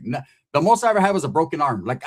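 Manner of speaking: ordinary delivery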